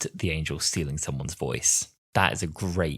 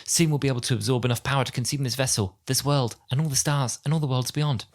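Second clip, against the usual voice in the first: high, quick, polite character voice; Angel Bob; 5 semitones higher than usual. Acted. Angel Bob reporting the angels' feast to the Doctor, high voice. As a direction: recite the threat evenly, pitched higher. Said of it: "Bob goes like".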